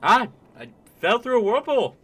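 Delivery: put-on high, loud, quick Canadian accent; excitable Canadian character voice. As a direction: chirp it out as a broad Canadian. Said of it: "just gonna be Canadians".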